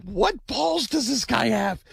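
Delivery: high-pitched, drawn-out disbelief; incredulous, high, stretched-out outburst; retold astonishment. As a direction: exclaim it slowly, way up high. Retells his astonished reaction to letter.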